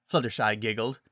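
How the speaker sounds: plain reading voice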